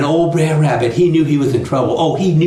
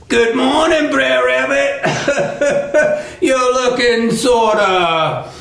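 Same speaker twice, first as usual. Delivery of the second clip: high, slow, laughing taunt; gloating Brer Fox; 10 semitones higher, much slower than usual. Acted. Fox greets his trapped rabbit, high gloating singsong. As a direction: tease with drawn-out words and chuckles. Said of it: "then he starts to laugh".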